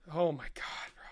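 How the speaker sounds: hushed, unvoiced aside; whisper. Real, not acted. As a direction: breathe it out in disbelief. Whispered reaction.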